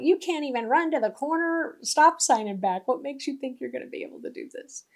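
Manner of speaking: imitating a scornful doubter. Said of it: "that's kinda what our, our lower voice sounds like".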